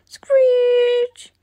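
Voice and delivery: high, loud screeching brakes. Acted. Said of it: "children use their brakes to stop".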